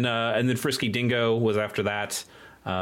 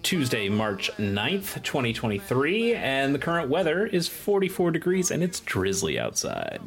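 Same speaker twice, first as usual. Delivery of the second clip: airline captain; 4 semitones higher than usual. Acted. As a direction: welcome passengers over the intercom. Pilot intercom announcement.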